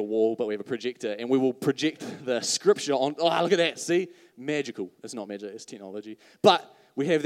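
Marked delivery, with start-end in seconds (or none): none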